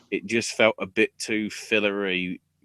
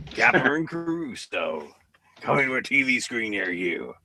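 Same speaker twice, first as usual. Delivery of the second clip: mock TV trailer voice. Mocking the pair's names as an eighties crime show trailer, announcer voice. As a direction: sell it like a cheesy crime show promo. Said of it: "sounds like a fucking, like, Cagney and Lacey crime thing".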